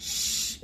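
"শ-" as a pure hush of escaping air; airy sh sound. Shown as a demonstration. Teacher shows the breath coming out, airy "শ-". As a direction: let the air flow out on a voiceless "শ-". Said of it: "এরকম বাতাসটা বের করবে".

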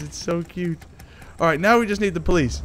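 normal talking voice

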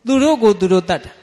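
a preacher driving home the point; emphatic declaration. Shown as a demonstration. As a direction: ring out the answer သူတို့ကိုသူတို့တတ်တာ with force.

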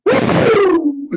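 high, loud wrestler whoop; Ric Flair woo. Acted. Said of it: "You do Ric Flair".

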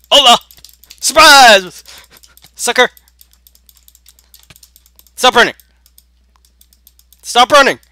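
high, loud taunting yell; gleeful ambush shout. Real, not acted. Player ambushing a fleeing opponent, shouting.

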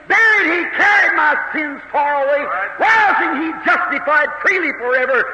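impassioned preacher declaiming in waves; fervent preaching. Real, not acted. Preaches at full force.

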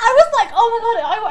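high, loud fangirl shriek; excited squeal. Retold or imitated. Fan retells seeing her idol at the window, screaming.

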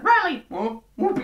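sharp high call of a name; shouted name. Retold or imitated. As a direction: yell his name to snap him out of it. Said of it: "Kendall's just like".

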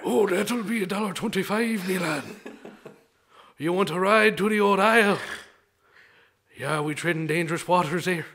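lightly done Irish ferryman; playful put-on Irish lilt; Irish accent. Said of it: "an Irishman who's living in New England".

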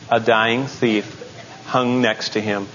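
ordinary delivery

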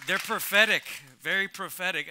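ordinary talk, nothing marked

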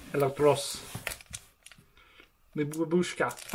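Electros with a rolled Russian o; hammed-up pretend Russian; fake Russian accent. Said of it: "me pretend to speak Russian".

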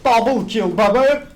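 loud triumphant cheer; loud hype call; excited cheer. Real, not acted. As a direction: crow over the double kill. Celebrates a double kill.

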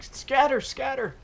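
high squeaky mock panic cry. Gamer imitating enemies fleeing like mice, squeaky mock panic. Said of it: "He's like".

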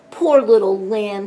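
loud, mildly tearful grandma; lightly weepy storybook voice; sniffled. A narrator's reading. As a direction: pity the child with a touch of sniffle. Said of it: "sniffled Grandma".

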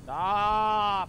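startled yell. Real, not acted. Streamer yelling at the monster in panic.

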